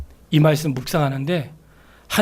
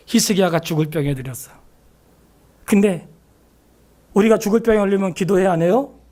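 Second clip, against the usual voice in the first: ordinary talk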